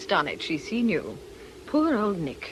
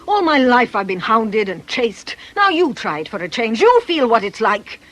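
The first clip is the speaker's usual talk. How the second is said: furious outburst; 4 semitones higher, 8 dB louder than usual. Acted. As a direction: shout him down with years of resentment. Aggie rounds on Nick furiously.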